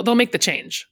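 normal talking voice

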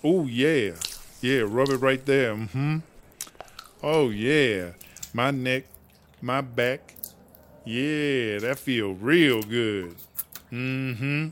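soft, slow pleasured murmur; sighing with pleasure, unhurried; relishing a back rub. Acted. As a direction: melt into the massage, low and drawn out. Enjoying a sunburn lotion back rub.

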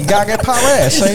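hammed-up old-movie tough guy; 1940s gangster voice. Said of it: "He's a nineteen forties gangster".

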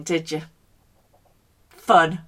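ordinary talk

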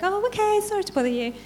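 high, mock-meek quick surrender; lightly hammed-up apologetic retreat; meek giving up. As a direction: shrug it off and back away politely. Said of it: "I'm like".

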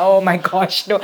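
exhausted groan. Retold or imitated. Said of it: "I was very tired".

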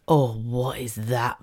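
slow, soft, wondering little voice; drawn-out hushed curiosity; curious wonder. Retold or imitated. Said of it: "a light bulb of".

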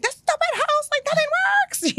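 shrill-free but high mock meltdown; hammed-up retold fury at the house; frantic exasperation. Retold rage hunting smoke detectors.

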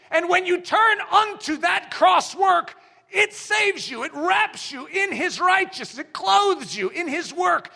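rising altar-call sermon voice; fervent preaching. Acted. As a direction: plead with their souls at full force. Preaching builds to full force.

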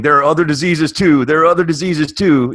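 loud, mocking, silly repetition. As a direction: whine it like a pest. Comedian's joke quote, repeated line.

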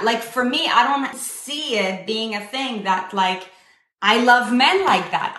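usual speaking voice

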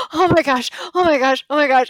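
high, loud retold panic; overwhelmed panic. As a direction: repeat it as if about to cry. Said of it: "my eyes start welling up".

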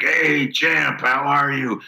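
a hint of a mentor's big hello; deep-voiced mentor. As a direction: imitate his drawn-out, hearty greeting. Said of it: "He had this deep voice".

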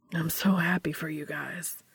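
whispered through sniffles; choked-up happy whisper; teary whisper. A narrator's reading. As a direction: brother sniffling into a hug, whispering. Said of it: "Sam sniffs into Dean's hair".

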